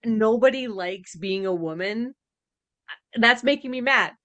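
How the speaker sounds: ordinary talk, nothing marked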